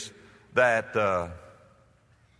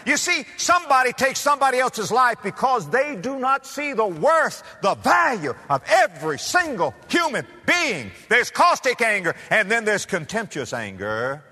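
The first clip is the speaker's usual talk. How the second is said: preaching; 8 semitones higher than usual. Real, not acted. Preaching at full force.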